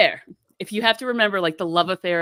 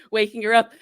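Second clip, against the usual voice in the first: usual speaking voice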